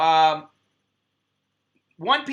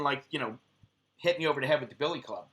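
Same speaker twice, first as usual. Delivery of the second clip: ordinary talk, nothing marked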